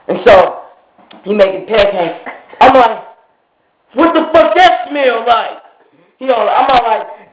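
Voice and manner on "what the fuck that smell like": slow, high-pitched disgusted complaint; indignant retold question. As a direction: draw out the irritated question. Retold complaint about the cooking.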